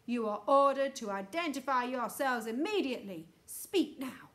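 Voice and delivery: loud, commanding official announcement; booming loudspeaker voice. A narrator's reading. Space Control challenges the trespassing astronauts, booming and stern. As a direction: boom out orders like mission control. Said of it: "boomed the voice".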